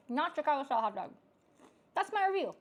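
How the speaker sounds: loud, high, fast final verdict; emphatic verdict. Acted. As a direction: declare it with mock outrage. Exaggerated verdict.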